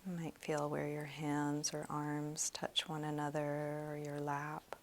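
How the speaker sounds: soft, slow meditation voice; guided meditation. Real, not acted. Meditation teacher leading students through a body scan, soft and slow. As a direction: guide them gently through a body scan, leaving long pauses.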